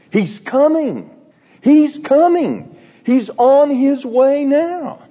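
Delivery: high, eager news that he is coming; rising excitement, slowing on each phrase; excited. Retold or imitated. Messenger rushing back to the centurion, excited.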